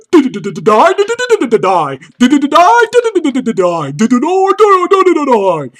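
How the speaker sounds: rapid-fire nonsense syllables swooping up and down; mock rap gibberish. Comedian mocking a rapper's horrible accent, in swooping gibberish. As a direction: babble "die" in a wildly pitched parody of rapping. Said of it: "with your horrible accent".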